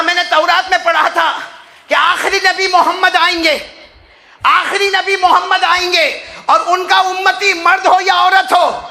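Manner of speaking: high, loud impassioned preaching; fiery Urdu sermon storytelling; preaching at full force. Acted. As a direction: drive the story home at full cry.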